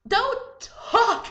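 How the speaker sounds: high, loud exasperated cry; exasperated outburst. Acted. Vlogger scolding people who talk in movies, exasperated outburst.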